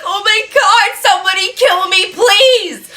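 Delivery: loud, high-pitched hammed-up wail; mock desperation. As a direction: beg theatrically to be put out of your misery. Acted desperation amid crying.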